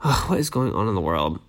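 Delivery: low, soft dismayed sigh of a question; dismayed groan. Real, not acted. Podcaster reacting to a shooting story, low and dismayed. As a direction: sink into quiet disbelief at the news.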